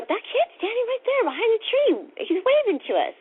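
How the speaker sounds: high, emphatic pointing-out; incredulous insisting. Retold or imitated. Insisting to sister who can't see.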